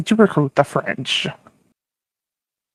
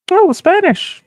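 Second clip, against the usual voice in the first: much higher, squeaky "oh, the Spanish"; voice jumping way up; 14 semitones higher than usual. Acted. Norway reacting to the Spanish as allies, voice jumping way up. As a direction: let the voice shoot up and crack on it. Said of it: "what the fuck happened in Norway's voice".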